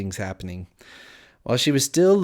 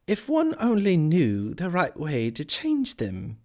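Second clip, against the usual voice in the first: lightly voiced higher, slow Alice; higher, unhurried musing to oneself; 8 semitones higher, slower than usual. A narrator's reading. Alice musing to herself, slow and higher.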